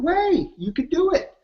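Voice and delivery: very high, rising teasing reply; playful "way". Retold or imitated. Answering the fireman's "no way" with a high playful retort. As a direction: bounce it back at him playfully.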